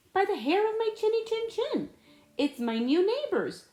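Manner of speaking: chirpy little pig greeting the neighbors. Acted. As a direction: play a cheerful little pig. First little pig greets them.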